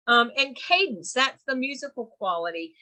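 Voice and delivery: ordinary talk, nothing marked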